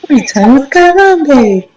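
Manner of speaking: high, mock-grand repeat of the show name; mocking TV host. Cohost mockingly echoes the show title, hammed-up high voice. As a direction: ham up the sign-off like a cartoon host. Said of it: "Don't ever do that again".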